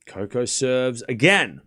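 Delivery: brisk running commentary, rising at each name; live play-by-play. Acted. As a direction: call the rally as it happens.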